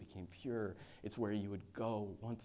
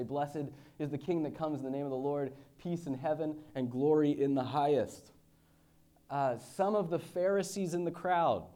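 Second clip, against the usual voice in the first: raised, louder preaching read; scripture reading; 6 semitones higher, 10 dB louder than usual. Acted. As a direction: proclaim the passage to the congregation. Reads scripture aloud.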